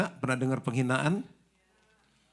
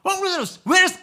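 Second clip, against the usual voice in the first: high, loud demanding "Where is"; angry English demand; 8 dB louder than usual. Acted. Husband angrily asking in English, loud high demand. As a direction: bark it like an angry American. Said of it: "kalau marah kan pakai bahasa Inggris".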